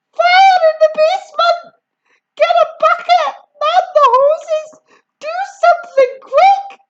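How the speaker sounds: high, loud frantic yelling; panicked yelling. A narrator's reading. Grandma calling for buckets, high loud frantic yell. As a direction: rattle off the orders in panic. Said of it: "the old woman yelled".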